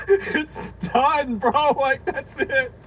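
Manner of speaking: laughing. Real, not acted. Commentator laughing at clip.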